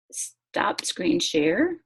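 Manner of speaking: ordinary talk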